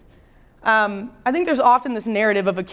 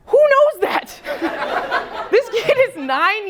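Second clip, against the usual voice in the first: amused disbelief; 11 semitones higher, slower than usual. Real, not acted. Teacher amazed by the kid.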